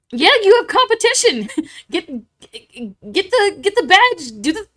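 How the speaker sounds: high, loud, giggly cheering; gleeful egging-on. Real, not acted. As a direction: cheer them on, breaking into giggles. Egging viewers on to compete.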